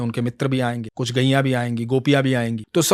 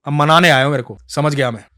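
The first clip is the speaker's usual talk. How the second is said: louder, huffy retort; flaring up; 6 dB louder than usual. Acted. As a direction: brush him off angrily. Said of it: "तो कृष्णदास भड़क गए".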